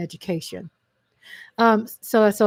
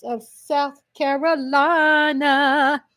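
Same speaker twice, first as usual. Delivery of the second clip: stretched-out, higher sustained delivery; drawn-out relish; 4 semitones higher, much slower than usual. Real, not acted. Host announcing a South Carolina congressman, drawn-out relish. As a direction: draw the state name right out.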